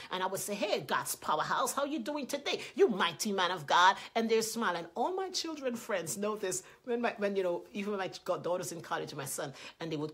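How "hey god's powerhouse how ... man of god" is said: hearty greeting. Retold or imitated. Mother greeting her sons.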